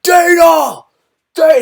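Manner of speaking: "Dana!" yelled very high and loud, each call falling; imitating screaming. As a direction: mimic a fighter bellowing for the boss's attention. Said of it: "he was screaming out".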